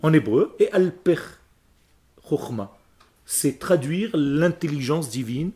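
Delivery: ordinary talk, nothing marked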